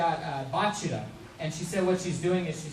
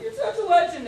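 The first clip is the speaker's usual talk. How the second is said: high, loud imitation of a starstruck fan; overwhelmed fan; 8 dB louder than usual. Crying fan meeting their favorite voice actor, overwhelmed. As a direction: squeal it like a shaking, star-struck fan. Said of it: "they were just shaking and crying".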